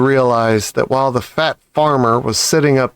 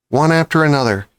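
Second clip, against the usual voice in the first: usual speaking voice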